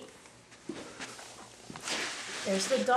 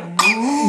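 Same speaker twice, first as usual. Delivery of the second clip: imitated moo for baby; cow moo; 5 dB louder than usual. Shown as a demonstration. Parent modeling cow sound.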